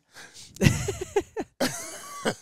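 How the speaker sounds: shrill burst of laughter; high squealing laugh. Real, not acted. Laughing at team failing.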